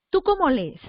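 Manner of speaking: ordinary talk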